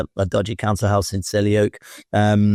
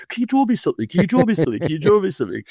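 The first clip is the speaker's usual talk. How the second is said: put-on high pleading kid voices, the pitch easing down with each repeat; eager kids begging; 8 semitones higher, faster than usual. Kids at his table begging him to draw, in high eager voices.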